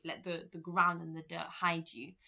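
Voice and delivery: ordinary talk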